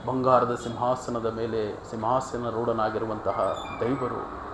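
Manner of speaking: low, soft, measured exhortation; quiet, weighty pastoral delivery; solemn preaching. Real, not acted. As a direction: bring it down to a hushed, reverent appeal.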